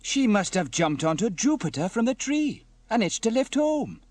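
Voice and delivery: high, loud amazed realisation; excited explanation. Acted. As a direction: marvel aloud at the discovery. Sam delighted at finding the cat.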